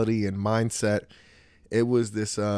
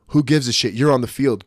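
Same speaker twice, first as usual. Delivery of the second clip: ordinary talk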